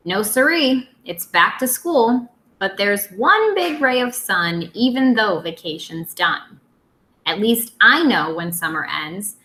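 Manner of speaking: put-on lively storytime voice for young kids; reading a picture book aloud. Teacher reading to her second graders, lively storytime. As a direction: read it out to the class with bounce.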